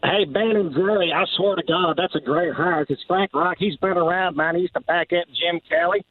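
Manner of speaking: high, excited radio-caller voice; Donnie Baker. Retold or imitated. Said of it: "Donnie Baker reacting to Frank Reich".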